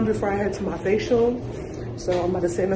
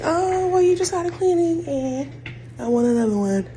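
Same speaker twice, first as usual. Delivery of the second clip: mock receptionist; 11 semitones higher, 3 dB louder than usual. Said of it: "So they were like".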